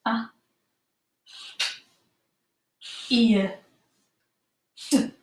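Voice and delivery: a, ear, d spoken as separate pure sounds; sounding out words. Shown as a demonstration. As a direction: segment appeared into its sounds. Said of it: "only got four sounds".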